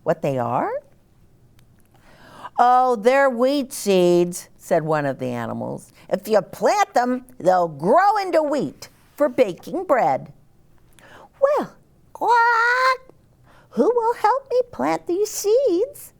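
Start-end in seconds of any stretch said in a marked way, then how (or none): 2.6-4.5 slow, higher farm animal voice. Acted. Animal voice in story. 6.1-10.3 higher animal voice. Acted. Animal character voice. 11.4-16.1 very high, clucky hen voice. Acted. Little red hen voice.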